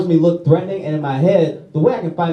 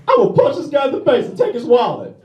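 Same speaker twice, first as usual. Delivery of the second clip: high goofy tough-guy voice; mock thug thinking aloud; mugger voice; 10 semitones higher than usual. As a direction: voice the mugger's plan high and dopey. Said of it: "His plan was".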